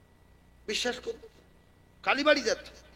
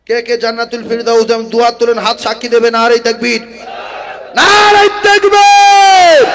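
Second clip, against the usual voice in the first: loud rising calls, longer and higher at the end; building rally shouts; 6 semitones higher, 19 dB louder, much slower than usual. Real, not acted. Preacher rousing the gathering to answer, shouting. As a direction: whip the crowd up to a roar.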